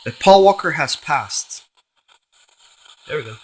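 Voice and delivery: usual speaking voice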